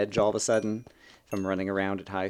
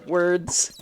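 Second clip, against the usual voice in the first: mock frustration; 7 semitones higher, 3 dB louder than usual. Real, not acted. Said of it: "I'm a Let's Play commentator".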